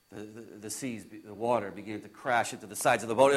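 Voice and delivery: very low, soft, suspenseful hush; hushed storytelling. Acted. Disciple recalling rough seas in the dark, hushed. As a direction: drop right down, low and quiet.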